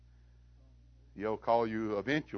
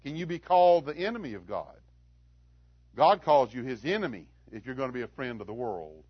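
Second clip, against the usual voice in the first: ordinary talk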